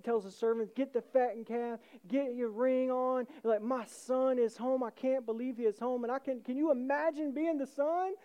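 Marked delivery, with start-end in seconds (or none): 0.7-3.3 higher, loud rallying call; rally everyone to celebrate; excited, raised voice; acted overjoyed father. Father orders servants to celebrate. 3.6-6.0 raised pitch, elated announcement; excited and higher; acted joyful father. Father rejoices at son's return.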